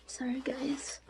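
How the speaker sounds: soft whisper. Real, not acted. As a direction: whisper the apology. Whispered apology.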